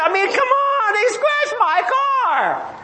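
high, slow, drawn-out outraged whine; mock whining complaint. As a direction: whine it like a petty grievance is the end of the world. Mock complaint about trivial worries.

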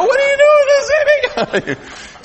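put-on very high, squeaky shocked child voice; mock toddler outrage; toddler's shock voiced. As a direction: play the outraged toddler grabbing at her cup. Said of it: "the look of shock on her face".

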